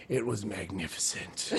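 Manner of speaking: dry friend, low and slow, hiding a laugh; deadpan praise. Retold or imitated. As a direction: savor the word like a connoisseur. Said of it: "he goes".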